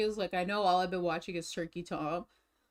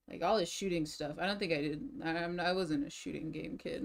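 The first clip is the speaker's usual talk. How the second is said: ordinary delivery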